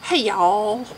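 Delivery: a sucky Southern drawl on the greeting; southern accent. Shown as a demonstration. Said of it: "I'm trying out my southern accent".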